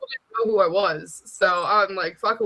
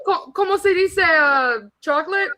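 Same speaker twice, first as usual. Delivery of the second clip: put-on Spanish accent; 9 semitones higher, 4 dB louder than usual. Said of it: "I'd be like".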